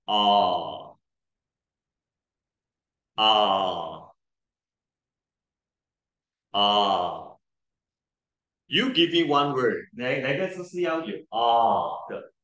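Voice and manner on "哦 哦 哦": 哦 drawn out long and low; teacher showing the lengthened vowel. Shown as a demonstration. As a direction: stretch the O long. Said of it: "还要拉长的".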